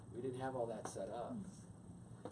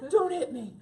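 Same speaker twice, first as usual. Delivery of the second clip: much higher, louder comic plea; mock scared plea; 12 dB louder than usual. Wife hiding in closet, high comic plea. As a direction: cower and beg playfully.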